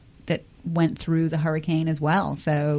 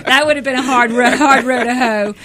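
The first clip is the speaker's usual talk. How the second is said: laughing; 5 semitones higher, 7 dB louder than usual. Real, not acted. Laughs at her own joke.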